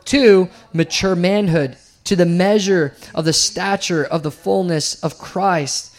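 loud, lifted public reading of scripture. Acted. Preacher reading scripture aloud to the church, ringing and emphatic. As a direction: proclaim the verse to the congregation.

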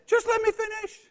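high, whiny just let me finish; teen brushing off a parent, high and whiny; mock whining teen. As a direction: whine it like a stroppy teenager. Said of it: "they say".

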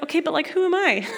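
amused self-mocking question; laughing question. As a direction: laugh at yourself as you ask. Laughing at her own question.

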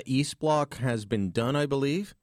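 usual speaking voice

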